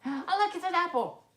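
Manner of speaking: high, bright-eyed oh look; delighted. Acted.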